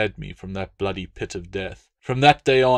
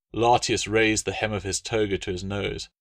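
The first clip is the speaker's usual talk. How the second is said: ordinary delivery, nothing marked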